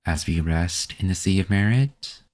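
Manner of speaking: ordinary delivery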